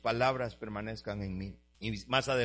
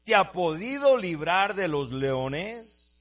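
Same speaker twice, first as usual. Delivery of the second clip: loud, higher, slow scripture proclamation; full-force preaching; 5 semitones higher, 7 dB louder, slower than usual. Acted. Preacher cries out the king calling to Daniel, loud and slow. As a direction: cry the king's words out over the church.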